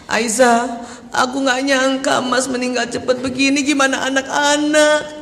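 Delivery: imitated plaintive wail, slowing at the end; tearful fear. Widow to her friend after crying, fearful for the children. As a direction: draw out the last words in worried mock weeping. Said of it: "takut, berarti udah naik".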